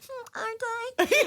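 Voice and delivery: tiny, high coy voice; hammed-up bashful squeak; mock modesty. Mock-modest reply to being called humble.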